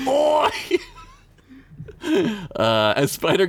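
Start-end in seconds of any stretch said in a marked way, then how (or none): 0.1-0.5 high goblin shout; goblin cry. Acted. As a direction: yelp it like a goblin.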